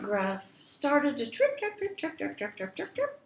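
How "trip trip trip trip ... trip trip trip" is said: light, quick, staccato trips; soft pattering rhythm; tripping hoofbeats. A narrator's reading. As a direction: tap out tiny hoofsteps with the word. Mimics little goat's hooves on bridge.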